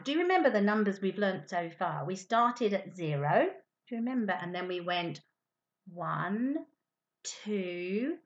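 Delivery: ordinary delivery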